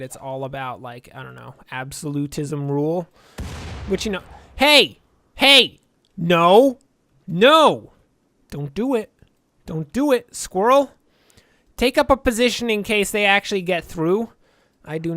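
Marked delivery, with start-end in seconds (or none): 4.6-10.9 sudden loud alarmed yells; shouting no. Real, not acted. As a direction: yell to stop them. Raid breaking in, shouting.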